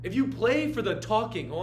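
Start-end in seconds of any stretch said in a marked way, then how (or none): none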